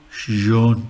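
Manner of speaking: Sohn with a long drawn vowel; long O umlaut. Shown as a demonstration. Teacher giving the long umlaut example, drawn-out vowel. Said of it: "long umlaut".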